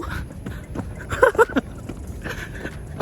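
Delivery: airy laugh after jumping; quick unvoiced laugh; breathless laugh. Real, not acted. Laughing after a jump test.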